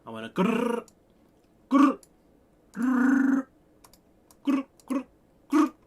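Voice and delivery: high, loud playful growling. Pretend. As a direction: growl like a synth bass. Demonstrating a growl sound.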